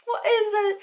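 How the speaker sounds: high, laughing disbelief; exasperated laughing. Real, not acted. Reviewer baffled by what the book even is, laughing. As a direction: laugh helplessly through the question, pitched high.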